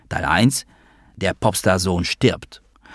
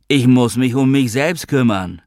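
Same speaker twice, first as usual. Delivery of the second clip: raised, higher voice; agitated outburst; 5 semitones higher, 6 dB louder than usual. A narrator's reading. Gary defends himself to Noah, loud and agitated. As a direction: insist with force, voice pitched up.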